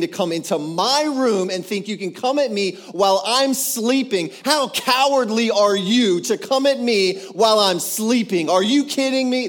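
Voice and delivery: building from firm to a loud, furious challenge; defiant rebuke. Retold or imitated. Friend rebuking a demon in the night, building to loud fury. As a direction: rise from steady scorn to shouting down the intruder. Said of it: "And I started thinking in my head".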